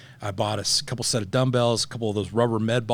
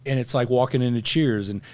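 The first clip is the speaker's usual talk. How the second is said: normal talking voice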